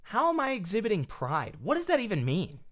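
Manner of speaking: high-pitched, incredulous questioning. Real, not acted. Streamer answering an accusation of pride, incredulous. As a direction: protest in a high, baffled voice.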